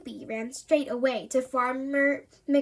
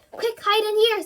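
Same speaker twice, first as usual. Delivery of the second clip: high, louder hurried command; urgent; 8 semitones higher, 5 dB louder than usual. A narrator's reading. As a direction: hustle them into hiding. Rabbit urges them to hide.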